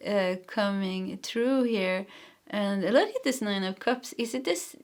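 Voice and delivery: ordinary talk, nothing marked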